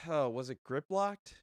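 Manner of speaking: ordinary talk, nothing marked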